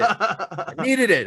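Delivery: slow, high, laughing through it. Real, not acted. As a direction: stretch it out while laughing. Repeats with laughter.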